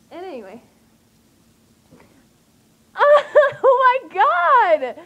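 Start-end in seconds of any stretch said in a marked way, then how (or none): 3.0-5.0 very loud, high, stretched-out cry; ecstatic outburst. Real, not acted. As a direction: laugh into an overwhelmed fangirl shriek. Fan reliving being followed by idol.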